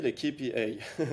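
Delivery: "les K P A" spelt out slowly in a mock English accent; light jokey English accent on the acronym. Shown as a demonstration. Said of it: "avec mon accent anglais".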